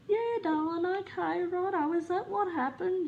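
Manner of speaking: high doting mum voice; imitating mum chatting. Mum chatting back to the baying dog, high cooing. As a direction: humour the dog like a toddler. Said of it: "mum will be like".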